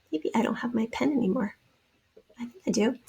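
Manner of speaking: half-whispered to herself; very soft, whispery muttering; whispered aside. Real, not acted. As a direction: talk to yourself under your breath. Muttered aside while hunting for pen.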